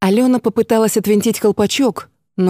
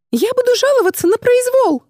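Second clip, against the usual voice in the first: высокий истеричный крик протеста; вскочить и закричать о произволе; возмущённый визг. Acted. (визгливо, с возмущением)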